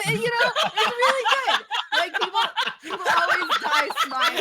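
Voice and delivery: high, loud laughing talk; giggling at his own joke. Real, not acted. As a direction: laugh through the words. Laughing through his pitch.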